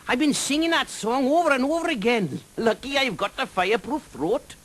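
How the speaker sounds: high, lilting Welsh dragon voice, friendly and teasing; a jolly Welsh dragon drawing out his words. Acted.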